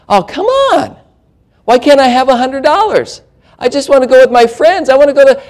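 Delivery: put-on high-pitched pleading teen voice; hammed-up teen begging for money; whining teenager. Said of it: "then they get a little bit higher".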